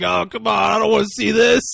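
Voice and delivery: loud, high-pitched cry of mock dismay; hammed-up yell of objection, played for laughs; mock protesting shout. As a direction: shout it like a dad begging not to watch. Acting out horrified father's reaction.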